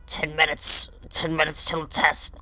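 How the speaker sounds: high, firm teacher voice refusing; stern teacher. Retold or imitated. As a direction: clip it like a strict teacher.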